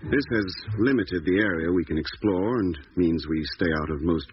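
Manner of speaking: measured, unhurried diary reading; diary narration. Acted. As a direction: read the log entry calmly over the music. Diary entry voiceover.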